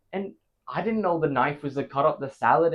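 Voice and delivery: ordinary delivery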